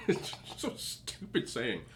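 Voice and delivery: laughing. Real, not acted.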